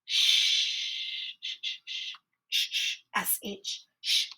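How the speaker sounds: whispered sh sh sh while writing; whispered sounding out. Shown as a demonstration. Teacher whispering the sh sound while spelling. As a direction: breathe out the sh sound, soft and unvoiced. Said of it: "begin with an uppercase".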